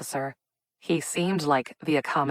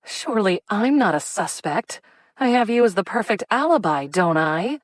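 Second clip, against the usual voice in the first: sardonic; 5 semitones higher, 4 dB louder than usual. A narrator's reading. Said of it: "she remarked in a sardonic voice".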